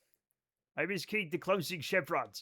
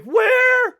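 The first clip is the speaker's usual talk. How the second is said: much higher, loud squawk of confusion; mock shocked; 14 dB louder than usual. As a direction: shriek the word in disbelief. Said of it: "They're like".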